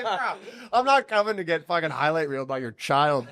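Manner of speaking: loud, high refusal through laughter; laughing protest. Retold or imitated. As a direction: protest incredulously while cracking up. Retold laughing refusal of sparring.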